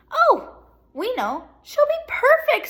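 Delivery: high, loud gushing; eager fan. A narrator's reading. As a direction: sing her praises, sure she'll be perfect. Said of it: "said a man".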